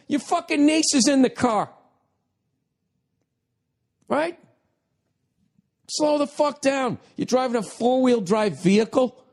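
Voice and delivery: high-pitched, exasperated mock yelling; cracking yell. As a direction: rant high as if screaming at the TV. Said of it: "My fucking voice cracking".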